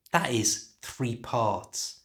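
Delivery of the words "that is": ordinary delivery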